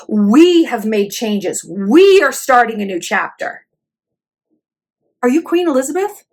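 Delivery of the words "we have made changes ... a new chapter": "We" drawn out slowly, leaned on hard; mock a speech, stretching each "We" long; stress on WE. Quoting the host's apology, heavy stress on "We". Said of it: "One thing she does say is the word".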